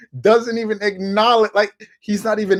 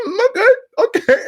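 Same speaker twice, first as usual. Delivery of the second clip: high, crying mock hurt; hurt Stephen A; 3 dB louder than usual. Stephen A. hurt after Kawhi ignores him, mock whimper. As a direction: whimper it like a wounded pundit. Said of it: "He's like".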